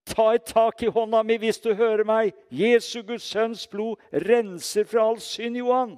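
loud, high, urgent call; shouting into his ear. Retold or imitated. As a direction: re-enact calling to a dying man. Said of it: "så ropte jeg inn i øret hans".

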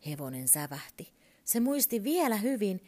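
usual speaking voice

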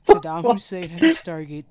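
loud, hammed-up conspiracy rant; mock frantic shout. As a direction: yell it like a wild conspiracy nut.

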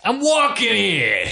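high, slow New York taxi driver yell; New York accent. Retold or imitated. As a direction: shout it like an annoyed cabbie. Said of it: "thick New York accent".

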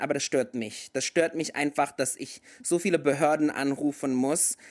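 ordinary talk, nothing marked

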